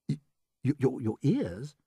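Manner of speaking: hesitant, stammered question; lightly voiced puzzled boy. A narrator's reading. James asked where grasshoppers keep ears, hesitant. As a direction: stumble over it, a touch baffled.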